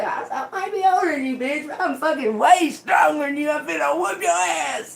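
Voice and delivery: high, laughing half-shouted threat. Retold or imitated. Teller laughs while threatening the young woman, high and laughing. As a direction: cackle through a mock threat.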